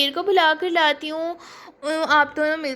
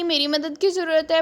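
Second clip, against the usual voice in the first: usual speaking voice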